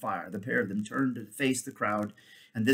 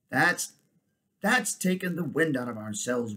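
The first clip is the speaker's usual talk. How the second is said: ordinary delivery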